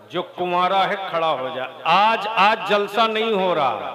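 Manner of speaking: loud, high fiery sermon call; preaching at full force. Real, not acted. Preacher urging bachelors to stand, fiery shout. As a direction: thunder at the crowd to stand.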